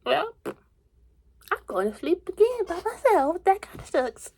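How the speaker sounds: mock self-pity. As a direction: whine it like a lonely night at bedtime. Acting out her nighttime self.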